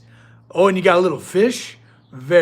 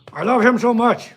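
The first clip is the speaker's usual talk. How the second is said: gushing through gritted teeth, pitched up; cute aggression; 4 semitones higher than usual. Real, not acted. Owner gushing over his dog, teeth-gritting cute aggression. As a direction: squeeze it out, overcome by how cute he is. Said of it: "grit your teeth because something so cute".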